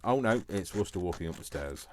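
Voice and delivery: normal talking voice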